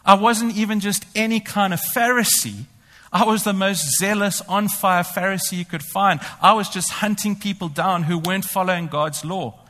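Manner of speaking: ordinary talk, nothing marked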